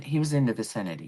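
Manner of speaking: ordinary delivery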